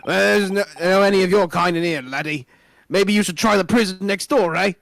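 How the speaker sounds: put-on amateur Scottish accent. Governor sends foreign visitors next door, attempted Scottish accent. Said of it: "someone to do a Scottish accent".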